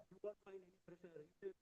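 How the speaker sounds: faint, dropping-out online audio; barely audible. Real, not acted. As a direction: voice fading out over a weak connection. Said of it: "Voice is not audible".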